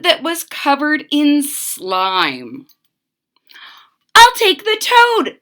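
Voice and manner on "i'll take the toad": put-on much higher, louder girl voice; little girl, decided. Mitzi chooses the slimy toad: high, pleased girl voice. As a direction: pick the toad with delight. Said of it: "said Mitzi".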